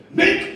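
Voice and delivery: very high, loud squeak; imitated punch noise. Uber driver punches him in the face, squeaked punch effect. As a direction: squeak it sharply as a cartoon punch.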